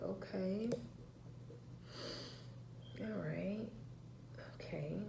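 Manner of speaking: soft, nearly whispered okay; hushed okay. Retold or imitated. Mom softly giving up on asking. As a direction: quietly back off, letting it go.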